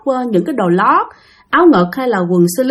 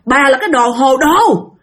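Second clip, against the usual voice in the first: high, heated yelling back; angry retort; 6 semitones higher than usual. Retold or imitated. Younger sister yelling back at her older sister. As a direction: hit back in a rage. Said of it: "Bạn tức lắm, bạn nóng lên".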